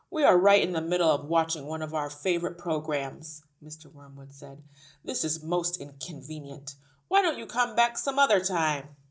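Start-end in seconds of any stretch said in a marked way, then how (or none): 0.1-3.4 loud, put-out ratty man; irritated father. A narrator's reading. Father interrupted watching his program, irritated. 5.0-9.0 irritated father. A narrator's reading. Father sends the teacher away, irritated.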